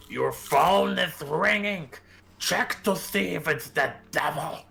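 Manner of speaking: slow screamed impression, high and loud; Tour Guide Nixon. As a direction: scream it in a put-on character voice, drawing out each word. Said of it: "In the Tour Guide Nixon voice".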